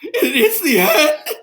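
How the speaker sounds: high, slow wailing lament; hammed-up tragic wail; dramatic despair. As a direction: wail it like the world is over.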